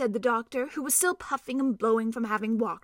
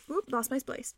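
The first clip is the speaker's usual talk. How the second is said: ordinary delivery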